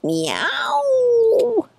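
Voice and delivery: long, high-pitched meow; drawn-out piercing kitten meow; deafening meow. A narrator's reading. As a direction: stretch out a high, wailing cat cry. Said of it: "in a deafening meow".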